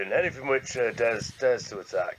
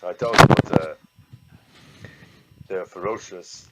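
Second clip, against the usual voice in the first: manly stern voice; 3 semitones lower, 11 dB louder than usual. Acted. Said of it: "wrong voice".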